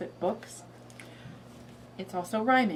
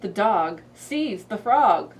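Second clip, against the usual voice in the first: put-on reading to a toddler; 8 dB louder, much slower than usual. Shown as a demonstration. Reads frog book.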